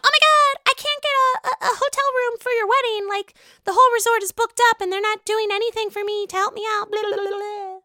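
put-on very high, squeaky frantic girl voice; mock panicked bridesmaid. Retelling the bridesmaid's panicked call to Scheana, squeaky and frantic. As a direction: play her freaking out, voice way up. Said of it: "she's, like, freaking out".

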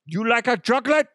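high-pitched cartoon German accent; hammed-up German accent; bad German accent. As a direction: call it out in mock German. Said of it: "don't even know what a German accent sounds like".

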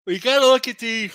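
speaking mid-yawn. Real, not acted. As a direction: yawn stretching the words. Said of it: "excuse me with my yawns".

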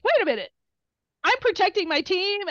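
retold indignant protest. Retold argument with yelling crowd.

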